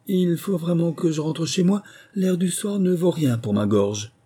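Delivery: put-on high-pitched old bird making excuses; old magpie. A narrator's reading. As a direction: fuss about your throat in a high old-bird voice. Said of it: "une vieille pie ... murmura".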